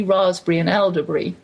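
ordinary talk, nothing marked

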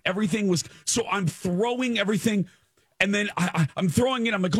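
high-pitched frantic retelling; reliving anxiety. Retold or imitated. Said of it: "I'm feeling the anxiety".

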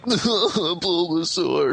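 very slow, drawn-out mock crying; mock sad Pokémon. Host spoofing the film's saddest part, mock crying. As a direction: moan it out like a weepy creature. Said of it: "making fun of, like, the, probably the saddest part".

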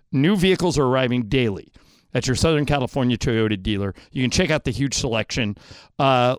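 upbeat live car ad read; radio ad read. Acted. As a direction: sell it like a radio spot.

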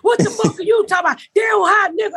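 high, hollered indignation; mock angry shouting. Bum arguing about race, high mock-angry holler. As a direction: act out a heated street argument.

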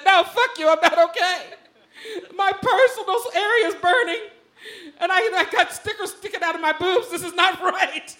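high, laughing, crying complaint; pained outburst. Retold or imitated. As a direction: complain in mock agony. Injured, yelling at friends.

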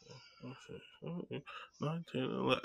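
very soft muttered counting; counting under breath. Real, not acted. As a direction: mumble the count to yourself. Recounting the list again.

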